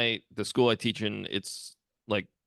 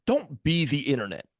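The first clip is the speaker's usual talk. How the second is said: rising higher, mock stern lecture; lecturing the kids; 4 semitones higher than usual. Teacher lecturing middle schoolers not to copy the internet, mock stern. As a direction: tell them off like a fed-up teacher. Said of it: "I was like".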